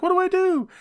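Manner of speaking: high comic helpless whine; Toru Yano. Retold or imitated. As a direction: wail it high, mock-helpless. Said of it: "He's like".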